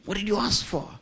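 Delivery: high sharp aunt's demand; a touch of a stern aunt. Retold or imitated. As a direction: voice the aunt pressing him. Aunt voiced demanding in story.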